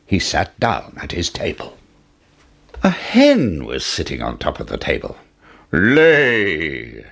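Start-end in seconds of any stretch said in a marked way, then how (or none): 5.7-7.0 gruff giant's barked command; shout. A narrator's reading. Giant commanding the hen, gruff shout. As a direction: snap the order at the hen. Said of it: "shouted the giant".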